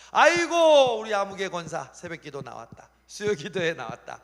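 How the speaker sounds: high, loud cry of delight; hammed-up joyful marveling; overjoyed God. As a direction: celebrate each small step proudly. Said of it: "하나님이 기뻐하시는 거예요".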